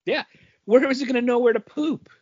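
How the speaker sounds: ordinary talk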